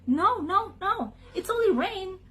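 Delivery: high, insistent duck voice; quick high-voiced reassurance; duck correcting. A narrator's reading. Duck corrects the panicking sheep.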